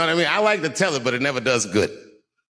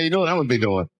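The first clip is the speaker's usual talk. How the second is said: ordinary talk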